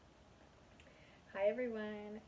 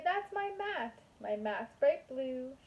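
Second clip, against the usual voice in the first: much higher, proud little Matman, slowing down; Matman's voice; 3 semitones higher than usual. Acted. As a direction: squeak it out proudly like a cartoon figure. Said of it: "He'd say".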